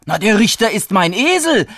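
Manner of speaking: insistent answer. A narrator's reading. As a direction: blurt it out as an obvious fact. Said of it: "antwortete er".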